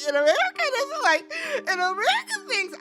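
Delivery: high whiny imitation of a crying girl; mock whiny wail. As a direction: wail it like a hammy crybaby. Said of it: "Huda is in her confessional".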